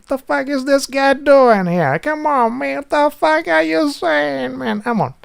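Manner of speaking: high, loud, slowed outrage at a stranger; Italian exasperation. Shown as a demonstration. Showing the meta language of annoyed Italians, high and loud. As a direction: play a fed-up Italian demanding an answer. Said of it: "there's the meta language, this kind of shit".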